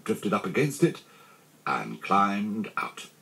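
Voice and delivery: ordinary delivery, nothing marked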